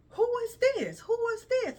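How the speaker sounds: high, sharp nosy schoolgirls demanding an answer; nosy girls. Retold or imitated. Girls in the bathroom challenge her: high nosy schoolgirl demand. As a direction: squeak it out like bratty teen girls.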